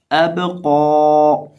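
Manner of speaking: अब कौ slow, bounced ब and long held कौ; lengthened heavy qaf. Shown as a demonstration. Teacher modelling recitation, slow अब कौ with long final vowel. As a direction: bounce the ब in अब, then hold a thick कौ on one pitch. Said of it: "एक अलिफ बराबर लंबा भी".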